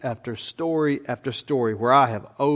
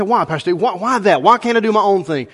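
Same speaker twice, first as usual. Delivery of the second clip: louder, higher mock complaint; protesting; 5 semitones higher, 5 dB louder than usual. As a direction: imitate a member pushing back at the pastor. Voices an objecting church member.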